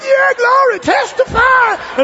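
high, loud drawn-out praise yell; hammed-up revival shouting; ecstatic shout back. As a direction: holler back at the preacher. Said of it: "I was like".

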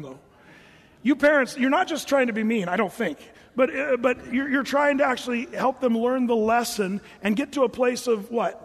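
ordinary delivery

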